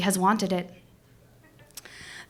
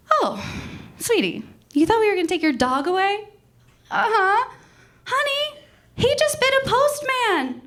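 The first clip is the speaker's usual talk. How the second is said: much higher, laughing dismissive sweetness; breezy officer voice; 12 semitones higher, 3 dB louder than usual. Retold or imitated. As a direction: coo it like it's no big deal. Officer reassures her laughing.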